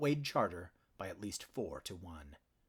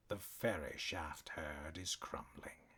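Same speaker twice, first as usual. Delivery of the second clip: deep voice, almost a whisper, slow; near whisper; 3 semitones lower, 7 dB quieter, slower than usual. A narrator's reading. Cat warlord murmurs his threat to the cornered stallion, near whisper. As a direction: hush it right down to a threat. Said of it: "his deep voice so quiet it was almost a whisper".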